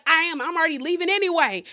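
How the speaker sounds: loud, high mock shout, quick; retold shout. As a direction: snap back loudly while storming out. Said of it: "Larsa's like".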